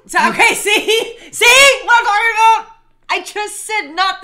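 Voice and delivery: loud, high, laughing see what I mean; triumphant shout. Real, not acted. Wife proves her husband wrong to the viewers, laughing and shouting high.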